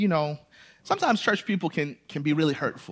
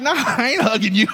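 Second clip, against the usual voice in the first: laughing refusal; 6 semitones higher, 6 dB louder than usual. Retold or imitated. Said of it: "I like".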